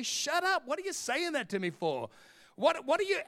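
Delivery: high-pitched, incredulous comeback; mock exasperated retort. Preacher replaying his replies to mum's preaching, exasperated.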